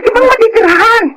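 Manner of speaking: high urgent plea to find a new place; urgent pleading. Acted. Friend pressing her to move out.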